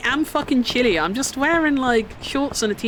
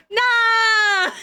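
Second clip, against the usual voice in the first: high crying shout; anguished shout; 11 semitones higher, 4 dB louder than usual. Real, not acted. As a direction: cry out in anguish. Game crashed.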